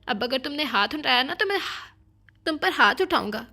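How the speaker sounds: loud, forceful ultimatum; heated, emphatic threat; angry threat. A narrator's reading. Acted quarrel, threatening to hit back.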